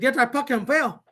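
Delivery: put-on high, playful Filipino accent. Dad insisting to his boys, in a mock Filipino accent. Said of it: "super good at their fucking accents".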